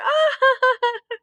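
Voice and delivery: high mocking laugh; howling laughter. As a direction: laugh at him like a pack. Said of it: "howled with laughter".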